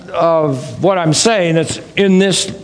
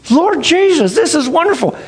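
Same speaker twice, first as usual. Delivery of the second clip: much higher, wonder-struck outburst; awed exclamation; 9 semitones higher than usual. Retold or imitated. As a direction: gasp it out to the Lord in amazement. Awe while studying creation.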